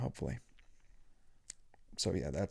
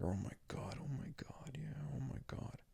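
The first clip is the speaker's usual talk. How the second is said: very quiet, whispery muttering; muttered exasperation; 2 semitones higher, 13 dB quieter than usual. Retold or imitated. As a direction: grumble it under your breath. Muttered aside during the haircut.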